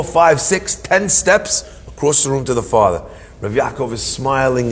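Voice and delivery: ordinary talk, nothing marked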